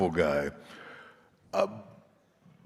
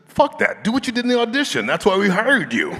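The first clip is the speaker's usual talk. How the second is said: loud, high, fast retelling of the director's rant; mock director outburst; 8 dB louder, faster than usual. Director telling the actor to drop the accent, loud and fast. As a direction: blurt it out like the fed-up director. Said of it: "Corey said".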